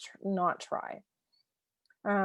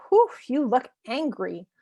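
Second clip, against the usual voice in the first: sympathetic naming; 5 semitones higher, 5 dB louder than usual. Shown as a demonstration. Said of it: "this might just be like".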